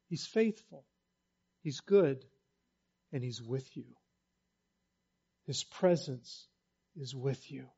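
quiet assurance of presence; tender reassurance. Acted. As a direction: comfort the listener gently.

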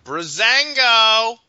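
slow, drawn-out, loud and high; excited. Real, not acted. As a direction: belt the name like an announcer. Echoing the team name.

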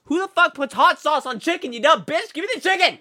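high-pitched furious outburst; angry rant. Retold or imitated. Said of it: "get so riled up".

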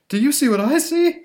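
high, loud startled vendor; hot dog vendor. Acted. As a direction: squeak it out in alarm. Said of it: "says the, uh, hot dog vendor".

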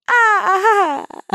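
stiff high mechanical "ah, ah, ah" laugh; robot laugh. Retold or imitated. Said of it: "like the first pass on the robot laugh".

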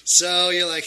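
ordinary delivery

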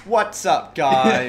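loud intro shout. Acted. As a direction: belt out the channel hello. Opening the first episode.

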